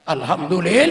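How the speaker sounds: loud rising call of Alhamdulillah; called praise. Acted. Preacher calls praise to audience. ("Alhamdulillah" diserukan keras dengan nada naik)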